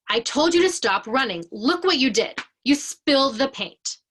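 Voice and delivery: loud, scolding teacher; a frustrated outburst at a kindergartner; reactive yelling. Shown as a demonstration. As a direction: yell at the child who spilled the paint. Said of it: "it might sound a little something like this".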